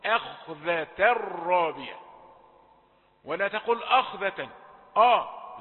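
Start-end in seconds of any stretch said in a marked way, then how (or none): 0.0-2.0 "أخذة الرابية" with n merged into a held r; slow, loud, no n before "الرابية"; merged tanween. Shown as a demonstration. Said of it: "ده أدغم في الراء". 3.8-5.6 "أخذة" opening on a dark back a; heavy hamza. Shown as a demonstration. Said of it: "لا، الهمزة رقيقة".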